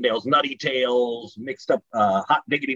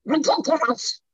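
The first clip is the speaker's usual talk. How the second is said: high buccal cartoon duck voice; Donald Duck voice. Shown as a demonstration. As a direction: squawk ridiculous like a cartoon duck. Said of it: "say ridiculous".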